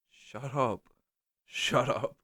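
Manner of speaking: restrained, exasperated aside; as if pleading under your breath for silence; mock exasperation. Passenger to a nonstop talker, mock-exasperated.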